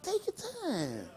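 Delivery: almost hush it, stretching each word; hushed advice. Real, not acted. Urging listeners to slow down.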